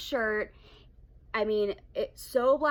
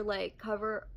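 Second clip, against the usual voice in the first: ordinary delivery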